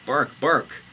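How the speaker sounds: high, loud spoken barks; cartoon dog barking. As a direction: bark the words out. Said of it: "barked Anubis".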